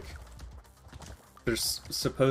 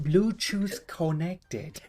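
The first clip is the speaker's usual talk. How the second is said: put-on slow, high Chinese-accented device voice; 7 semitones higher, much slower than usual. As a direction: copy the knockoff AirPods prompt. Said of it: "strong Chinese accent".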